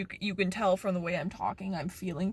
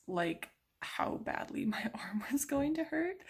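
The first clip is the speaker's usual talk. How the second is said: whispering; 6 semitones higher, 6 dB quieter than usual. Real, not acted. Whispered, laughing.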